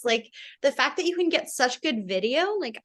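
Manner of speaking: ordinary talk, nothing marked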